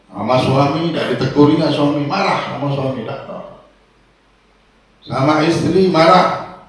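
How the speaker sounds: ordinary delivery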